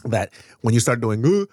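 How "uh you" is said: mock Indian accent. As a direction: caricature Indian accent, higher and louder. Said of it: "like, the Indian accent".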